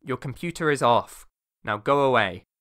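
booming cold dismissal; loud command. Acted. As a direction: order them off, loud. Villain dismisses player.

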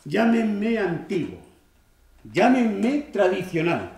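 indignant self-justification; defiant protest. Acted. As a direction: defend yourself emphatically, pitch lifted. Defends old-fashioned views to audience.

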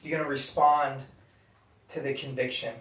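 soft, hushed altar-call voice; quiet appeal. Acted. Pastor asks the room about buried sin, hushed.

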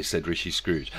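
ordinary delivery, nothing marked

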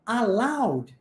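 English "Aloud", OW diphthong, ending on the d; English pronunciation. Shown as a demonstration. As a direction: model "Aloud" with no extra final vowel. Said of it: "a pronúncia é aloud".

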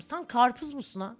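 ordinary talk, nothing marked

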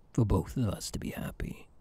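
tender hushed promise; soft whisper. Acted. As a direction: breathe it like a secret. Tender reassurance.

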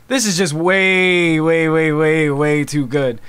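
long slow "way", repeated over and over; drawn-out emphasis. Real, not acted. Gushing over the trailer, "way" drawn out and repeated. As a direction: stretch each "way" slowly for emphasis.

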